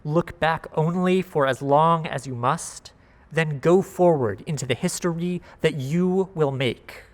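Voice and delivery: ordinary delivery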